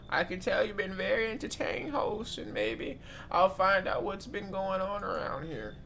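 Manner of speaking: grandma voice. Acted. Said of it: "My grandma voice kinda mid".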